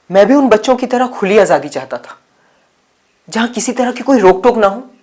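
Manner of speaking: loud, high, eager telling; excited reminiscence. Acted. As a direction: build up the boyhood dream with gusto. Recalls boyhood dream of freedom. (जोश भरी ऊँची आवाज़ में)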